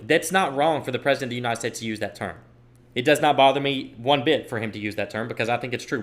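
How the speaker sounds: ordinary talk, nothing marked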